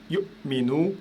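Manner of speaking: slow yu'minu, yu cut off by a throat catch; yu'minu split by a silent glottal gap; glottal stop demo. Shown as a demonstration. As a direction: stop the air after yu in yu'minu, then release minu. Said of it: "Pareil ici".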